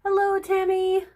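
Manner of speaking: high, loud sing-song hello; cheery greeting. Real, not acted. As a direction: call out brightly to a friend. Calls out a greeting.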